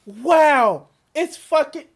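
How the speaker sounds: loud stunned wow; stunned outrage. Real, not acted. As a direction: react in shocked disbelief.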